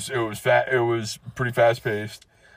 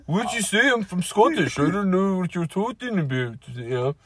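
high, thick mock-Scottish, slipping towards Canadian; hammed-up Scottish brogue gone astray; bad Scottish accent; 7 semitones higher than usual. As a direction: put on a badly faked Scottish accent. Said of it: "I don't know why he sounded Canadian right there".

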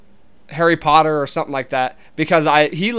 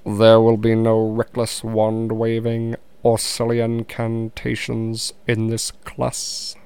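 low, slow, monotone professor; stern teacher laying down rules, very slowly; Alan Rickman impersonation; 5 semitones lower, slower than usual. Shown as a demonstration. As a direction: draw every word out in a low, level voice. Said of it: "this is a real Alan Rickman impersonation".

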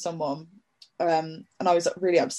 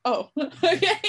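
higher, laughing relief; relieved surprise; 11 semitones higher than usual. Retold or imitated. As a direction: caught off guard, relieved. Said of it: "I was like".

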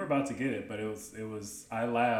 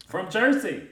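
Jersey accent; 9 semitones higher, 10 dB louder than usual. Shown as a demonstration. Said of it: "this Jersey accent".

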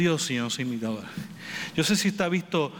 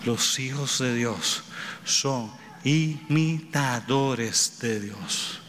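slow emphasis; 4 semitones lower, much slower than usual. Acted. Sermon point summed up.

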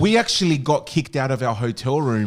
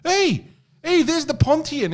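put-on excited old men; slower than usual. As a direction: call out like a cheeky old Greek man. Retelling the oldies greeting him.

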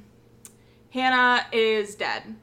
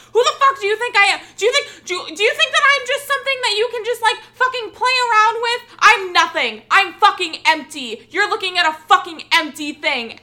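higher, louder, fast rant; furious outburst; 9 semitones higher, 7 dB louder than usual. Retold or imitated. Alex snapping at the fan who wanted a photo, fast furious yell. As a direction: explode at the stranger, rushing the words. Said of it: "she's just like".

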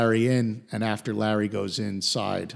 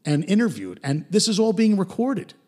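mock alarmed, higher and quicker; panicked Larry; 9 semitones higher than usual. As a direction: blurt it out in a nervous rush. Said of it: "Larry is concerned".

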